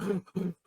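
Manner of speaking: imitated gasping for breath; soft mock winded panting; winded gasps. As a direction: act out catching your breath after a punch. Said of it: "winded, like".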